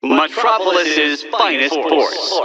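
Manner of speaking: usual speaking voice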